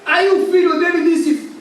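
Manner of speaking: high, loud preaching at full force; fiery preaching. Acted. Preacher tells the congregation the shipwreck story, fiery and loud. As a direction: storm the story out from the pulpit.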